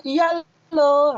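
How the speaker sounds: casual spoken tone. Shown as a demonstration. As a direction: say it like everyday talk, not reading. (nada santai seperti ngobrol)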